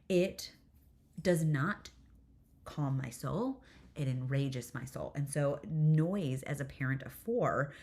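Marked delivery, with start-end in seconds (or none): none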